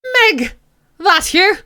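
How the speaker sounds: put-on Irish character voice; Irish accent. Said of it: "I'll make him Irish".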